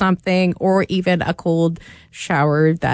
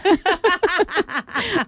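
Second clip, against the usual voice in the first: high-pitched laugh; 13 semitones higher than usual. Real, not acted. Laughs at own sarcasm.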